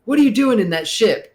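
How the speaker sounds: high, loud put-on voice of a game character calling out; NPC greeting. Host imitates a game character questioning the player, high and loud. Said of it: "When they say, like".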